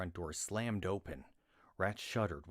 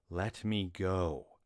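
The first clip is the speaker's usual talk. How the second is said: slow, louder, menacing order; lethal demand; 6 dB louder, much slower than usual. Acted. Rat threatening Glawin with a knife, slow and menacing. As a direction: make the threat truly genuine. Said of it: "Rat demanded".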